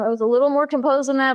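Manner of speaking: usual speaking voice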